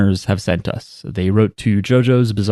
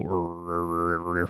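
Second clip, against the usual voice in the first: low, soft throaty "rrr" growl; growled English R noise; 5 semitones lower, 13 dB quieter, slower than usual. Shown as a demonstration. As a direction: growl R sounds, tongue not touching. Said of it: "We're just gonna make a noise".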